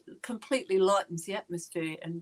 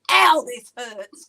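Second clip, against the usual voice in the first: slow, louder, drawn-out mock pain cry; mock labour cry; 4 semitones higher, 9 dB louder, much slower than usual. Retelling the play's birth scene, a louder slow mock cry of pain. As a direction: stretch it out like a girl in labour, half-joking. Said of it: "scream out having a baby".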